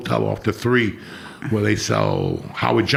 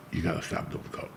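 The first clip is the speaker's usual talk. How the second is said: breathy hushed whisper, barely voiced; whispered warning; 4 semitones lower, 12 dB quieter than usual. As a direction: whisper it like a dying friend's plea. Dying friend warns him quietly.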